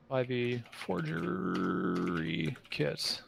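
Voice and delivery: drawn out while looking it up; slow search muttering. Real, not acted. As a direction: stretch each word as if typing it. Slowly reading out a search.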